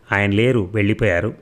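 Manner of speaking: plain reading voice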